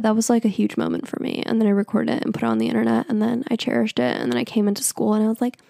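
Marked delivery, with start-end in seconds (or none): none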